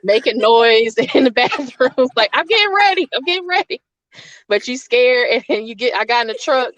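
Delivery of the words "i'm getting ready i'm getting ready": high mock teen. Teen stalling in bathroom.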